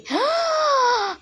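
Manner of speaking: sharp high gasp of pretend fright; mock gasp. Showing the toy anaconda to viewers, a high mock gasp. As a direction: gasp as if the snake just lunged.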